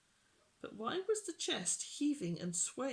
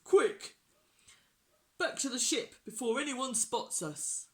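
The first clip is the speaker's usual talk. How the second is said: loud, raised pirate command; urgent order; 5 semitones higher, 6 dB louder than usual. A narrator's reading. Pirate chief rushes crew to ship, urgent. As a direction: hurry the crew along loudly.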